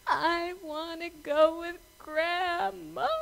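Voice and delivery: high, slow mock wail; crying little boy. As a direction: whine it out like a bawling child, drawn out. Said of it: "crying, and he's going".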